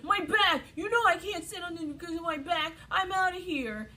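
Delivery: put-on high, whiny little-boy complaint; mock him as a petulant, whining child; Alex. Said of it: "It was my Alex whiny voice".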